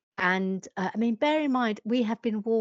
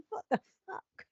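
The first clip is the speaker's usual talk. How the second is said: baffled waitress; 5 semitones higher, 5 dB quieter than usual. Retold or imitated. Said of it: "was like".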